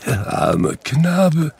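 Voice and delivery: slow, high, tearful sigh; tearful pity. A narrator's reading. Scrooge weeping at his younger self, tearful. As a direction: let the tears break through.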